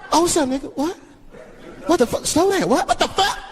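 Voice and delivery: high-pitched, climbing incredulous questions; mock alarmed disbelief. Driver hearing news on his phone, increasingly alarmed.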